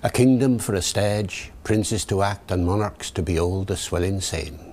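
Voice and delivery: Shakespearean English accent, rhotic R; original pronunciation. Shown as a demonstration. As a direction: Elizabethan original pronunciation reading. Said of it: "David the O P".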